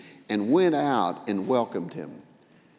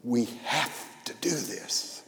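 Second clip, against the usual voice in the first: whispered, slow and soft; hushed plea; 6 dB quieter, slower than usual. Acted. Father quietly pleading with the older son, whispered. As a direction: breathe it like a secret.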